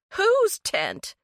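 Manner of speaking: the shock jumps up into a demand; horrified demand. A narrator's reading. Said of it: "Eleanor sounded horrified".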